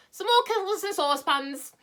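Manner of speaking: put-on a funny old pedlar; old Saucepan voice. Saucepan answers at once.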